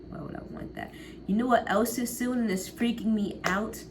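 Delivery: normal talking voice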